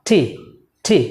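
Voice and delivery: টি with an aspirated t, like ঠ; correct pronunciation. Shown as a demonstration. Teacher modelling the correct letter name, aspirated t. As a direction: push a puff of air out on টি. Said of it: "একটা ধাক্কা দিবেন".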